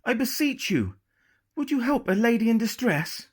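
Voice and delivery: high, pleading lady's voice; Lucy feigning distress to lure the pirates, high and pleading. A narrator's reading.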